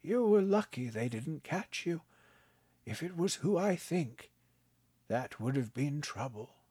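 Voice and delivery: put-on a kindly old man, gently amused; old grandpa voice. A narrator's reading. Grandpa teasing his grandson, kindly old voice.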